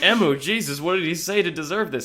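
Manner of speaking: high, aghast group exclamation; mock shock. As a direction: exclaim as the horrified bystanders. Onlookers react to the beating.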